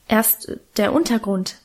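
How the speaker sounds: ordinary delivery, nothing marked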